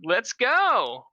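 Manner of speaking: high, loud eager call; Vivian voice. Acted. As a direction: pitch it up and call out. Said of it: "She goes".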